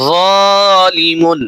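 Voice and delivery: তলিবুন said very slowly, the first vowel held one alif long; slow Quranic spelling blend; drawn-out blend. Shown as a demonstration. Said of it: "এক আলিফ করে টান দিয়ে পড়তেছি".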